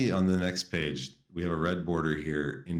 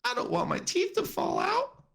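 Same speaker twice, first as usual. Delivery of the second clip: much higher, frightened monster voice; scared Fuzzy. Acted. Fuzzy begs Ollie for help, very high. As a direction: squeal it like a panicked little monster. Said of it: "Fuzzy cried".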